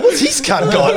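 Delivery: laughing nosy shopper. Retold or imitated.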